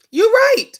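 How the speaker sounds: a high, loud burst of agreement on "right"; "right" thrown up high and loud, then dropping; emphatic agreement. Real, not acted. Host strongly agreeing with a viewer's comment, emphatic.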